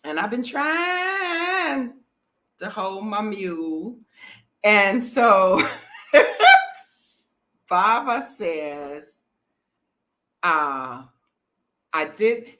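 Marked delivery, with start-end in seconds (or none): 5.7-6.7 high loud burst of laughter. Real, not acted. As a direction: laugh hard at it. Laughing at bossy men.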